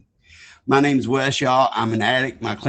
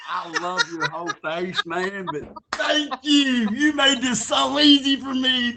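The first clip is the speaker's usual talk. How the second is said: laughing; 13 semitones higher than usual. Real, not acted. Teasing back, laughing.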